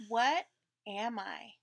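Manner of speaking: ordinary delivery, nothing marked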